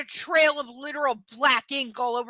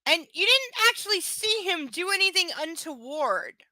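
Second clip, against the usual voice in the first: normal talking voice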